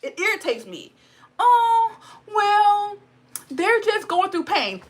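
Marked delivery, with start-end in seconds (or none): 1.4-4.8 mocking voice. Mocking excuse-makers in a rant.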